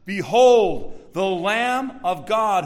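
loud, stretched-out proclamation; calling out. Acted. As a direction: cry it out slowly to a crowd. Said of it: "remember John calls out".

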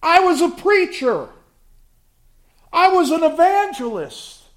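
high, loud, desperate pleading to the Lord; loud wailing plea of the rejected; pleading false believers. Acted. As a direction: beg the Lord with self-justifying cries. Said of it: "stand before him and say".

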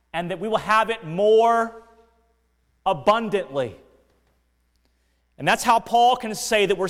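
preaching at full force. Acted. Preacher exhorting his congregation, full impassioned force. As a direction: lift it up and proclaim God's covenant glory.

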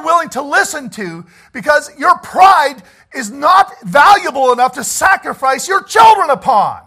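loud, high, impassioned shout; preaching at full force. Real, not acted. Preacher to his flock, pleading in a loud high shout. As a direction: hammer it home to the congregation.

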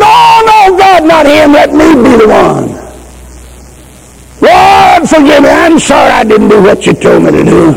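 high, anguished cry to God; a high, breaking plea for mercy, held to the end; desperate pleading prayer. Retold or imitated. Retold desperate prayer for the boy.

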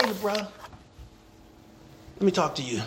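soft, low imagined overture; gentle friendly approach. Pretend. Someone reaching out to a lonely man, softly and kindly. Voicing a kind coworker's approach.

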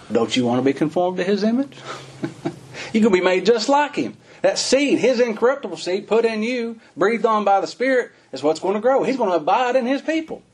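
high, fervent pulpit voice, pitch way up; preaching at full force. Acted. Preacher urging his flock toward Christ's image, fervent preaching.

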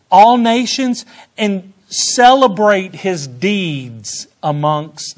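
loud, high preaching of the verse; preached quote. Retold or imitated. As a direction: proclaim the scripture with fervor. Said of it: "So he says".

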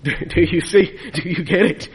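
ordinary talk, nothing marked

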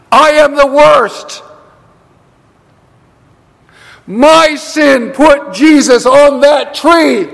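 slow, forceful confession from the pulpit; impassioned preaching. Real, not acted. Preacher owning his sins before the congregation, impassioned and emphatic. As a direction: hammer each phrase separately, with long pauses.

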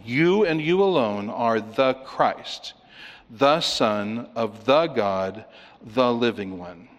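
slow, weighty "the" before each title; stress on THE. Shown as a demonstration. Preacher restates Peter's confession, slowly stressing "the". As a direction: draw out each phrase, landing on every "the". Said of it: "four definite articles".

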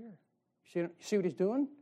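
usual speaking voice

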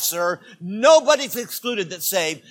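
normal talking voice

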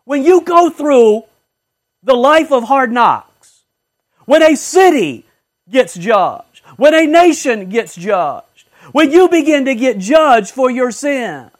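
loud, slow, rising pulpit appeal; full-force preaching. Acted. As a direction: build it up like a warning.

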